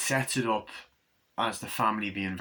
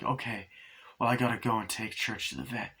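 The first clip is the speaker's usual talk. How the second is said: ordinary talk, nothing marked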